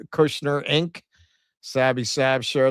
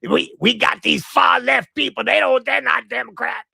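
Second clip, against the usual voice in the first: James Carville impression; 10 semitones higher than usual. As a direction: rant like James Carville about the left. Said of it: "to hear from James Carville".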